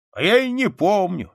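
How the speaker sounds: high, loud, carefree soldier's reply; breezy old soldier's bravado; devil-may-care. A narrator's reading. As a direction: toss it off recklessly, voice up. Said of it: "бесшабашно ответил солдат".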